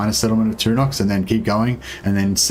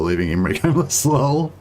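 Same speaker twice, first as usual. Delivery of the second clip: amused, breaking into a real chuckle; stifled laughter; 4 semitones higher than usual. Real, not acted. Streamer reading a viewer's defeat, trying not to laugh. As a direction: laugh despite yourself, then sympathize. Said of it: "I shouldn't laugh".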